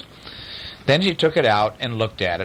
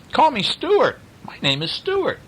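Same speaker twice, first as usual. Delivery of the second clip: eager insistence. A narrator's reading. Stuart insists, friendly.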